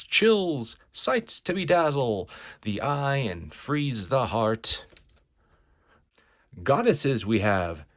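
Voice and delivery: loud, showy huckster spiel stretching the big words; carnival barker. Acted. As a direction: sell the show to a passing crowd. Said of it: "a carnival barker".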